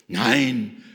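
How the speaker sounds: loud, indignant refusal; affronted, emphatic outburst. Acted. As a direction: protest it with sudden volume. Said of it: "sagt Petrus entrüstet".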